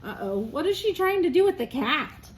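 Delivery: ordinary delivery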